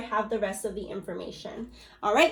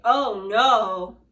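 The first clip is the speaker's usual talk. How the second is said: mock dismay; 7 dB louder than usual. Shown as a demonstration.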